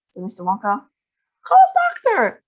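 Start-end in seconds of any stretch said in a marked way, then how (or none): 1.4-2.4 very high loud shout. A narrator's reading. Father shouts for a doctor, high and loud. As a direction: shout for help. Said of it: "shouted Mister Beauregard".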